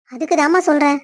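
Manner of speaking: ordinary talk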